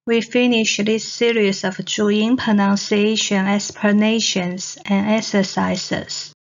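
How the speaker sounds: usual speaking voice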